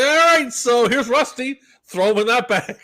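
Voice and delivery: high, loud mocking voice of the vet; hammed-up impression of the vet handing the cat over. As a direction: ham up the vet. Retelling the vet's words.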